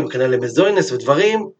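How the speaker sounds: ordinary delivery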